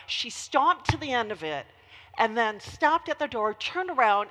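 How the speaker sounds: ordinary delivery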